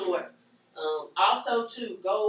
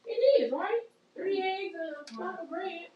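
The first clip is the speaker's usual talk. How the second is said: mock insistence; 10 semitones higher than usual. Teasing repeat of instruction.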